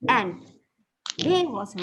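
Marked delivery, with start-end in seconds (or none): none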